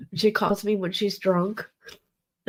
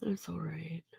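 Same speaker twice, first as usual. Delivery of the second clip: barely audible unvoiced whisper; whispered aside; 3 semitones lower, 18 dB quieter than usual. Real, not acted. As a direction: breathe it out, almost to yourself. Quiet aside after reading chat.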